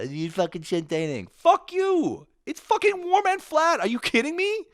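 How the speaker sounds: shrill mock rant. Mock furious rant, strange voice.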